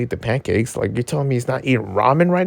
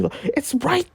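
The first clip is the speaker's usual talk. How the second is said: high-pitched yell held back in volume; restrained yell. Real, not acted. Insisting fans see the obvious match, a stifled high yell. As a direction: squeal it high without really yelling. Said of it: "I can't yell".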